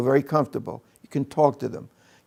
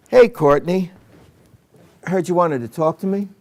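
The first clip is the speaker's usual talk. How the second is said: loud, higher, overbearing hello; pushy, raised-voice opener; mismatched greeting; 3 semitones higher, 4 dB louder than usual. Shown as a demonstration. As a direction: come on too strong, with a pause after the name. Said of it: "this is called a mismatch".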